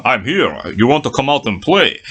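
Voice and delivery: low-pitched mock Russian accent; Russian-accented call to come and play; accidental Russian accent. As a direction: summon them in a put-on Russian accent. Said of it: "don't know why I'm doing a Russian accent".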